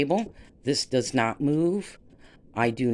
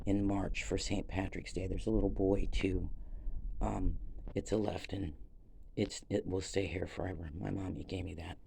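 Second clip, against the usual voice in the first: much softer and lower, near tears; barely holding it together; tearful and soft; 7 semitones lower, 11 dB quieter than usual. Real, not acted. Mother's gift, voice fading.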